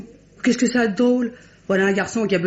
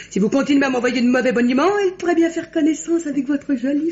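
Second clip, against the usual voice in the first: half-laughing tease, pitched up; giggling warning to a flirt; 6 semitones higher than usual. Acted. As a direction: laugh through the playful threat. Teasing Martin after laughing.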